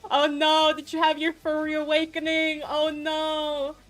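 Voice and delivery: high, loud, laughing outcry; laughing mock dismay. Real, not acted. Teasing viewer about furry awakening, laughing mock dismay. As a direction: exclaim in amused horror, voice lifted high.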